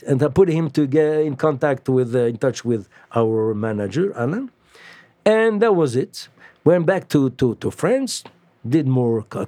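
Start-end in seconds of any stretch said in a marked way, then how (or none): none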